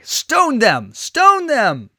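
high, loud chant-like yell; escalating mob yell; crowd cry. A narrator's reading. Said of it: "Others picked up the cry".

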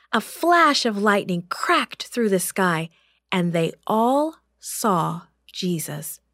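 ordinary delivery, nothing marked

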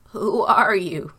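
put-on loud, trembling old father's question; shaking voice. A narrator's reading. Father shocked by a second son, shaking voice. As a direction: tremble hard on every word. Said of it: "In a shaking voice he asked".

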